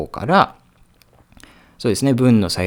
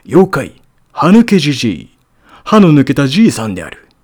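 narrator voice; 5 semitones higher, 8 dB louder than usual. Shown as a demonstration. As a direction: announce it like a TV narrator. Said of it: "ナレーター風に".

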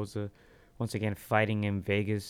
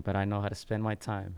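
ordinary talk

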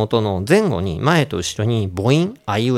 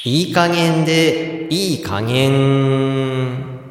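very slow, drawn-out remedy name reveal; sing-song product-name call; gadget announcement; much slower than usual. Pretend. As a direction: hold the final syllable long and steady.

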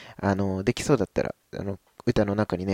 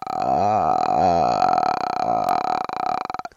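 very low, slow creaky ah; edge voice. Shown as a demonstration. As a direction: rattle out a slow throat creak. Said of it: "う感じですかね".

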